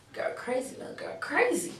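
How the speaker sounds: breathy hushed whisper; hushed disbelieving aside. Real, not acted. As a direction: whisper it like a secret to the viewer. Whispered aside about the story.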